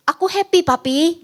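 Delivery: put-on a child cheerfully telling Papi; happy child. Parent quotes a happy child. (anak bercerita dengan gembira, suara tinggi)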